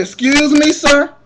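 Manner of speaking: high, loud stunned question; shocked disbelief. Retold or imitated. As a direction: blurt it out in disbelief. Shocked reply to bail amount.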